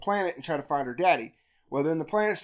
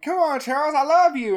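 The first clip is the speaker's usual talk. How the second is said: higher, louder mock plea; pleading; 8 semitones higher, 5 dB louder than usual. Meg begging her brother, a mock plea higher up. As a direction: beg him sweetly, overdone. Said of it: "And then Meg is like".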